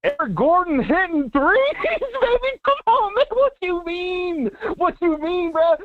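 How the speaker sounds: high, hyped, laughing; excited. Real, not acted. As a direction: let it burst out. Caller erupts in excitement.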